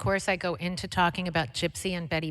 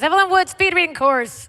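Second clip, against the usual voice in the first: excited; 12 semitones higher, 10 dB louder than usual. Real, not acted. Excited recall of course name.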